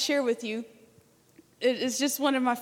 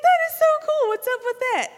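much higher, louder thrilled gush; excited; 10 semitones higher, 5 dB louder than usual. Retold or imitated. Recalling her delight at the chanting man, excited high gush. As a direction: squeal it like the best surprise ever. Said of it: "And I was like".

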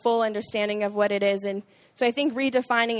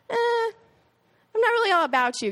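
dismissive shrug; 11 semitones higher than usual. Retold or imitated. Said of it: "I was like".

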